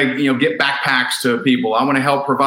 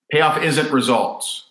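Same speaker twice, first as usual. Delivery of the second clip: ordinary talk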